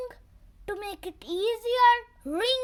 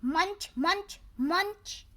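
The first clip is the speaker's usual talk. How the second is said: ordinary delivery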